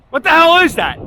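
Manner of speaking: high, loud alarmed yell; alarmed cry. Retold or imitated. Statue figure to his friend, high loud alarmed yell. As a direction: shout it at the strange thing. Statue guy asks again.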